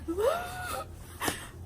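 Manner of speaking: high sobbing gasp; crying gasp. Retold or imitated. Breaking down at work, sobbing gasp. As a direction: gasp through tears.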